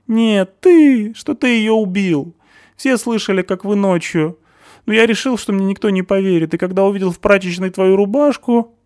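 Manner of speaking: high, loud accusing voice of a panicky man; Stasik's shrill accusation. A narrator's reading. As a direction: point the finger and shout it high.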